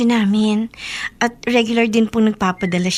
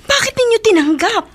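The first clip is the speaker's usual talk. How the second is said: surprised; 10 semitones higher, 4 dB louder than usual. Acted. Shocked she accepted money.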